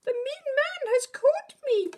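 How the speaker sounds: very high, loud squeak; squeaking for help. Acted. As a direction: squeal for help like a tiny rabbit. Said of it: "squeaked as loudly as he could".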